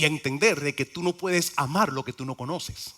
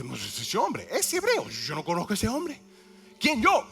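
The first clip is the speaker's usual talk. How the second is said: Peter's denial; 6 semitones higher than usual. Acted. Peter denies Jesus.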